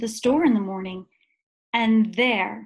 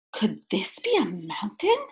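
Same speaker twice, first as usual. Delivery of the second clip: hushed, awed whisper; wondering whisper. A narrator's reading. Corduroy wondering on the escalator, whispered. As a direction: breathe the question in wonder. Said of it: "he wondered".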